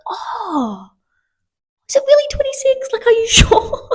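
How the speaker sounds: high, breathy disbelief breaking into a laugh; giggly fake-astonished whisper; whispered mock disbelief. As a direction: pretend to be shocked, barely holding in laughter. Pretending disbelief at the weight.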